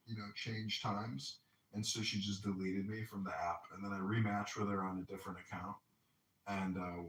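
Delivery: normal talking voice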